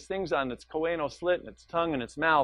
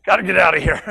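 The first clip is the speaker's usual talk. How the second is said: loud, urgent little fish voice; panicky fish; 2 semitones higher, 10 dB louder, faster than usual. Retold or imitated. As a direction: yell it like a fish fleeing the current. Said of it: "He's like".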